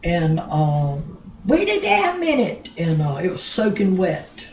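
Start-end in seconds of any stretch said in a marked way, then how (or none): 1.5-2.7 high-pitched retold holler; imitating a yell, voice jumping way up; calling out. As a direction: call it out high and sudden to stop someone.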